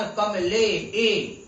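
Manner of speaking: कमले ए very slow, ले and ए held long; stretched ए sound. Shown as a demonstration. Teacher showing what आ and ई make, long-held ए in कमले ए. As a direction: draw out the ए in कमले, then repeat it alone. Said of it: "आ और ई मिलकर क्या बना".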